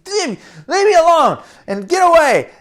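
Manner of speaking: put-on squabbling child voice, high and loud; high angry yell. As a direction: yell at your brother to back off. Boys fighting.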